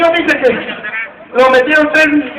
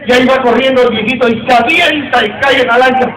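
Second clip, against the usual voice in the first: ordinary delivery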